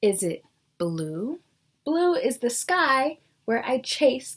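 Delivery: lively storybook reading with rising questions; teacher reading a picture book to the class; reading to children. Acted. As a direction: read each guess like a curious cat. Reads the picture book aloud.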